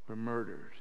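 normal talking voice

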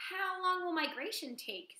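eager little goose asking; young gosling. Acted. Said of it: "said Bright Bill".

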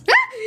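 sudden loud cry of fright; startled yell. Real, not acted. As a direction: yell as you get caught off guard. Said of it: "Ben ne güzel bağırdım lan az önce". (ani korku çığlığı)

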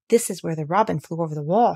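plain reading voice

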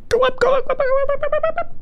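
very high pleading chant; very high, frantic go-up chant; desperate squeaky urging. Real, not acted. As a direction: squeal at the screen begging it to work. Desperate urging of game character.

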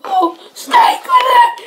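very high frightened squeal; mock panicked shriek; startled shriek. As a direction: jump at the snake. Sudden snake in woods.

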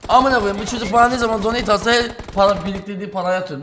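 fast, loud rant. Real, not acted. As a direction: rant at the donor. Ranting about donor's messages.

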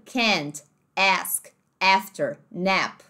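can't, ask, after, nap with a flat American A; American vowels, ask like ehsk; American accent. Shown as a demonstration. Teacher lists words in American English. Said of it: "no inglês americano".

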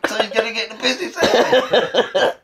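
high voice through real laughter; cracking up while explaining; laughing. Real, not acted. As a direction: barely hold back the giggles. Laughs at the absurd deal.

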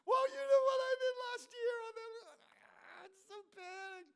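high whimper fading to a thin whine; hammed-up guilty blubbering; mock wailing confession. Believer confesses last year's sin to God, hammed-up tearful whine.

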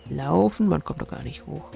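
normal talking voice

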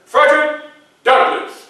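loud, high announcement of a name; calling out a name. Acted. Guard announcing the visitor loudly. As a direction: call the visitor's name across the hall.

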